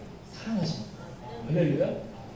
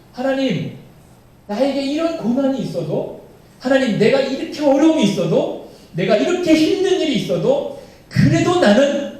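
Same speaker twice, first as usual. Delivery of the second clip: higher, louder pleading cry to God; fervent confession; 7 semitones higher, 6 dB louder than usual. Acted. Preacher voicing David's vow of trust, fervent and raised. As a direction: plead your trust in God despite hardship.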